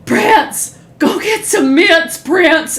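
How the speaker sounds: high, hammed-up holler; comedian's bit impression. Retelling a comic's bit about asking [name] for mints, silly high impression. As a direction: pester a friend in a silly high voice. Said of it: "this is my impression of somebody asking [name]".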